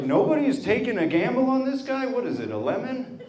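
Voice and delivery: high-pitched, incredulous mock outburst. Townspeople sizing up an unmarried bachelor, incredulous. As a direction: balk at a dubious bargain, voice jumping up high. Townspeople mocked as they doubt him.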